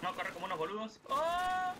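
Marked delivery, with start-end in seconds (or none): 1.1-1.7 high, loud, held "oooh"; startled "oh" cry. Real, not acted. Player running into enemy fire, startled high cry. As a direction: let out a high, sustained yelp.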